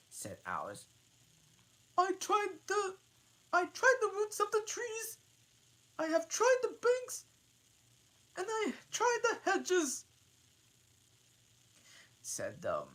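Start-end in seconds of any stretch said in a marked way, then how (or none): 2.0-10.1 high, loud bird voice ignoring her; high pigeon voice. Acted. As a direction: rant on like a shrill pigeon. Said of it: "pigeon went on, without attending".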